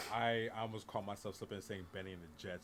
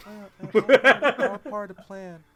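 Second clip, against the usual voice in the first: higher, louder put-on character voice; mock movie voice; 10 semitones higher, 21 dB louder than usual. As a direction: ham up the famous line. Quoting Dune line for laughs.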